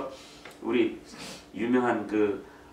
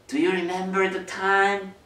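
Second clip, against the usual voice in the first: put-on higher, very slow, drawn-out girl's question; grown daughter asking; 10 semitones higher, 6 dB louder, much slower than usual. Grown daughter asking her mom, higher and slow. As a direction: coax the memory out slowly. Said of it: "뭘 기억하냐면".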